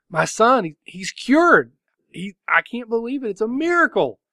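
high, overjoyed voice, slowing on cured; almost unable to believe the good news; elated. Acted. A guard announces his sick son is cured, elated. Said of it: "he's so excited and he's elated".

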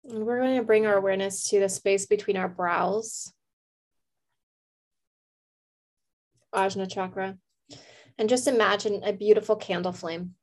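calm meditation voice with long pauses; guided meditation. Real, not acted. Host leads listeners to a candle flame, calm meditation. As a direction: guide them gently, leaving space to breathe.

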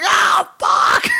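very high, strained mock yelp of pain; acted pain cry. Retelling getting cut by the lawnmower hook, hammed-up pain cry. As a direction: cry out as if just hurt, hammed up.

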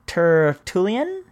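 "Tertullian" very slowly, rising at the end; careful sounding-out. Shown as a demonstration. Host trying an early author's name, "Tertullian" slow and unsure. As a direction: stretch "Tertullian" out as a question.

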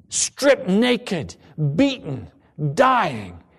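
rising fervent sermon climax; impassioned preaching. Acted. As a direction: drive the list of suffering home.

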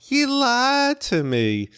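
high, slow Southern twang, warming up; slow Southern accent. Shown as a demonstration. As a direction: stretch out You lied to me in a high Southern accent. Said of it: "warming up going".

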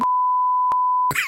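squealing helpless laugh; high-pitched burst of laughter. Real, not acted. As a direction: breaks into a shrieking giggle. Cracking up at own impression.

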